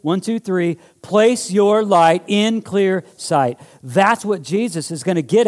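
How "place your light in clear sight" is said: slow, higher, louder rallying chant; like you mean it. Shown as a demonstration. Pastor urging the church to say it with conviction, slow and strong. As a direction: put conviction into every word. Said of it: "say it like you mean it".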